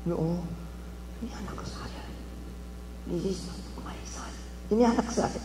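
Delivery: hushed, breathless mother in disbelief; mother whispering amazed at her son; stunned whisper. Retold or imitated. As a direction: whisper it in shock.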